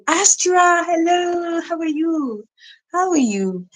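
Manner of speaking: high, drawn-out delighted hello; thrilled, high-pitched welcome; excited greeting. Real, not acted. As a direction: greet her in a high singsong voice. Greets a viewer joining the stream.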